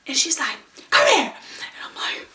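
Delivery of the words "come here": high, loud retold command; sudden loud mom voice; mom's sharp order. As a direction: act out an angry mother summoning her child. Angry mom orders child over.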